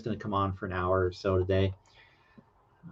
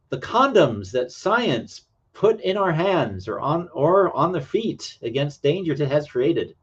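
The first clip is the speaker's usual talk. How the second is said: dramatic read-aloud; 5 semitones higher than usual. Acted. Reading an old paragraph to stream viewers, dramatically. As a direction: declaim it like a stern melodrama.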